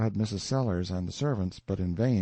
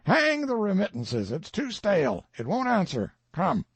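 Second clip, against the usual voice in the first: higher, sharp dismissal of excuses; impatient outburst; 11 semitones higher, 3 dB louder than usual. A narrator's reading. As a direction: brush the excuse aside hotly. Collector cuts off excuse.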